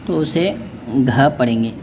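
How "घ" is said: घ said as a breathy voiced gh; aspirated gh. Shown as a demonstration. Teacher to Urdu learners, breathy घ sounded out. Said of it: "गाफ के साथ हे".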